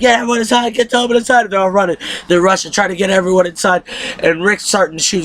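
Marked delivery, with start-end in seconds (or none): 0.0-1.4 urgent call. Retold or imitated. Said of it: "He's like".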